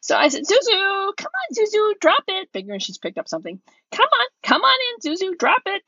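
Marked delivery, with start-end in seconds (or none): 0.4-2.5 high coaxing call to the dog; sing-song the dog's name higher. Retold or imitated. Owner coaxing Zuzu to drop it, high dog-calling voice. 3.9-5.9 high coaxing call to the dog; sing-song the dog's name higher. Retold or imitated. Owner coaxing Zuzu to drop it, high dog-calling voice.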